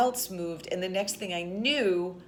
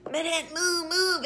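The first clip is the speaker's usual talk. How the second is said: high-pitched mock drunken yell; imitated screaming; 12 semitones higher, 4 dB louder than usual. Man standing over her who won't stop, screaming. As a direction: imitate a man shouting the same order over and over. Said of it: "screaming at me".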